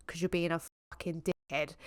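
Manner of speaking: soft, fast, nearly whispered; muttered put-down of the troll; muttered insult. Real, not acted. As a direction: drop it under your breath. Insult said under breath.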